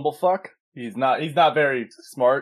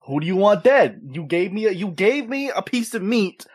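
put-on Brooklyn accent; 5 semitones higher than usual. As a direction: play a Brooklyn wiseguy with menace. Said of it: "he got a whole Brooklyn accent going on too".

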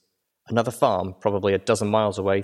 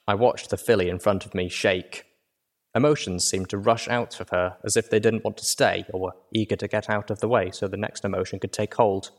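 usual speaking voice

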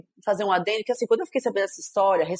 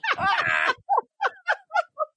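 laughing at the uncle's excuse; high laugh. Real, not acted. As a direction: burst of high-pitched laughter.

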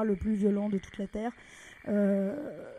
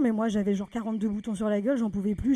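ordinary talk

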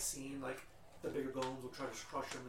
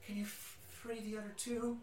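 put-on weak little girl voice; 7 semitones higher than usual. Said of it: "she's so weak, she's like".

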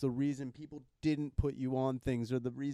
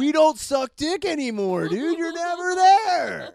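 whiny addict buddy; 10 semitones higher, 8 dB louder than usual. Retold or imitated. Acting out addict friend calling.

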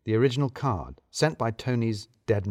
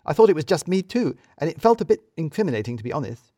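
higher, louder admission fading; sheepish Edie; 6 semitones higher, 5 dB louder than usual. Acted. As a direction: own up, a bit embarrassed. Edie confesses.

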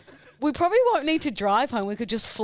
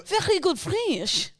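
slow, higher hammed-up French accent; mock French accent; 6 semitones higher, slower than usual. Showing off to the co-host in mock French accent. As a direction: draw it out in fake French. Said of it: "You're great at French".

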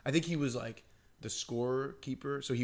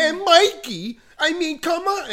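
Coach Weiss impression; 12 dB louder than usual. Teen teasing a teammate in the coach's voice, high and loud. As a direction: drag out the coach's disappointment. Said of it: "the Coach Weiss breakdown".

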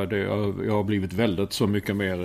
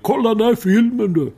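put-on loud, very high drunk Stockholm Söder accent; a tipsy Söder guy shouting at his seatmate in a Stockholm accent; drunk Södermalm voice; 12 semitones higher, 7 dB louder than usual. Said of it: "Det lät ju som en berusad söderbo". (berusad söderbo-röst, hög och ljus)

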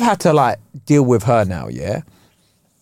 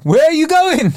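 much higher, louder mock yell; shouting at her; 13 semitones higher, 3 dB louder than usual. Comedian yelling after walking-out heckler, mock shout. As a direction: call after her as she leaves. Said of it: "I start shouting".

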